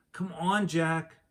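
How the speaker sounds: high voice calling up from below. Acted. Annie calling Jack from below the treehouse, high call. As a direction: call up the ladder to him.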